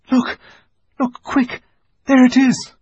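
put-on high, loud excited girl; excited shout. Susan excitedly spots the beaver.

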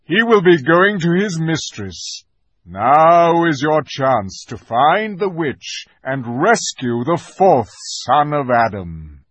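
loud, high commanding call; rally the troops with ringing orders; Aslan calling orders. A narrator's reading. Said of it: "shouted the voice of Aslan".

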